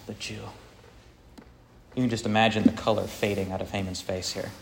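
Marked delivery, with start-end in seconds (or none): 0.1-0.4 quiet twist. Retold or imitated. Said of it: "he twists it a little bit".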